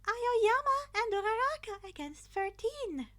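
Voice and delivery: high, loud chirpy announcing voice; cheerful principal. A narrator's reading. Principal announcing the teams to the class, high cheerful call. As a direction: announce it like a bubbly host.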